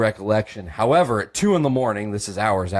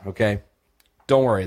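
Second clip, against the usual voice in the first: normal talking voice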